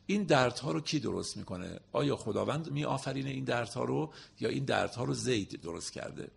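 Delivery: ordinary delivery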